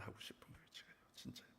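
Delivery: prayer trailing off into a whisper; a whispered, spent ending to the prayer; fading to a whisper. Retold or imitated. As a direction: let the plea die away to breath. End of tearful prayer.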